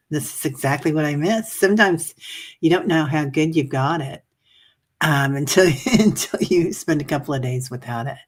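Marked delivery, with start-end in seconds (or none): none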